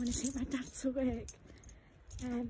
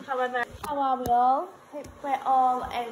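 loud greeting; 9 dB louder than usual. Real, not acted. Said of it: "That is too low then".

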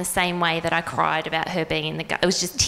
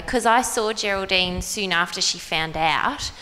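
ordinary delivery

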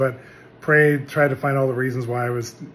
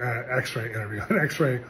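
ordinary talk

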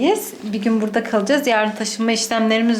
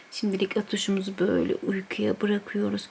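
hushed; 2 semitones lower, 7 dB quieter than usual. Real, not acted. Mother by sleeping child.

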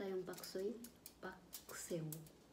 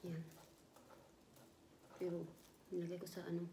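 eating between words; talking while chewing; 8 dB quieter, much faster than usual. Real, not acted. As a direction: mouth full of food. Seen 1: Lips closed, chewing; hand puts food in mouth mid-stretch.

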